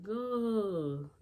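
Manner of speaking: loud, high, drawn-out exclamation; retold astonishment. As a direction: imitate a stunned mom, stretching the word. Mom reacts opening the envelope.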